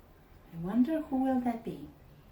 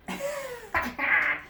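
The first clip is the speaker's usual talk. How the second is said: much higher mocking burst of laughter. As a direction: cackle at the disguise. Guys laugh at fake coach.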